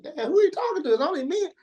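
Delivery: high, confused double take; baffled disbelief. Retold or imitated. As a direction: react like you can't believe he means you.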